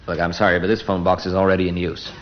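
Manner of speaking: normal talking voice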